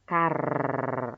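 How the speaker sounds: CAR with a clearly sounded, drawn-out final R; rhotic American R. Shown as a demonstration. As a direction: say "car" American-style, holding the R at the end. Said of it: "R-nya dibaca". (car dengan bunyi R akhir yang jelas)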